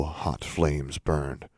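plain reading voice

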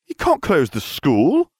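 high, slow outraged cry; a boy crying out in protest; exclaimed. A narrator's reading. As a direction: protest it loudly and slowly. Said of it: "I exclaimed".

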